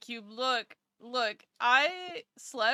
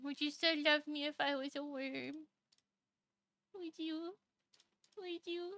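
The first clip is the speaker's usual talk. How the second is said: cutesy plea; 4 semitones higher, 8 dB quieter than usual. Pretend. Cutesy question to chat.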